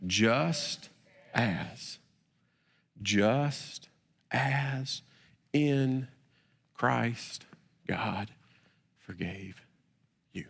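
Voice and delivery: every word spaced with a pause, softly and slowly; word by word recitation. Shown as a demonstration. Preacher leads the church saying it together, word by word. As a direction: let each word land alone, hushed. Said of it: "can we say that together".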